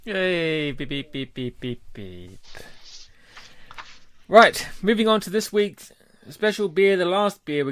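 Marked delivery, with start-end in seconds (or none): none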